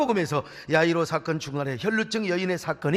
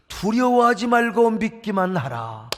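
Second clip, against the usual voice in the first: calm, slow, measured delivery; slow solemn command; slower than usual. Acted. Jesus speaking to Jairus, slow and calm. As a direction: speak it slowly and gently first.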